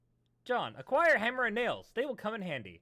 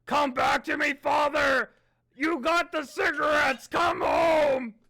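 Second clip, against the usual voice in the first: loud, higher, melodramatic wail; hammed-up dramatic reading; 4 semitones higher, 6 dB louder than usual. Narrator reads the note with hammed-up mock grief. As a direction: cry out for your father in mock anguish. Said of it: "reading this in my dad's voice".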